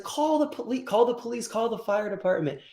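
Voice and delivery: very high frantic mock plea; frantic owner. Owner begging the old lady to call help, high mock panic. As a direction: rattle off orders in a panic.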